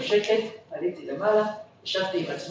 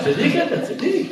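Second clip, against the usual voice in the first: laughing praise; 9 semitones higher, 3 dB louder than usual. Retold or imitated.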